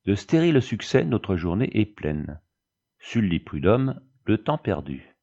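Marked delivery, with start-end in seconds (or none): none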